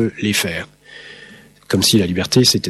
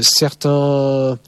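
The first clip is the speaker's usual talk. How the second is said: ordinary talk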